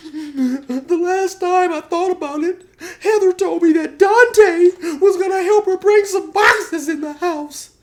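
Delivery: very high, hammed-up weeping; [name]. [name] recalling what Heather told him, exaggerated falsetto weeping. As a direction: tell it through squeaky mock tears. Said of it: "[name] is crying and stuff".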